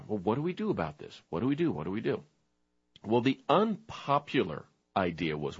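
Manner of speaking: hushed storyteller, understated; story narration. A narrator's reading. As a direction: tell it like a grave bedtime tale. Tells a story in softer voice.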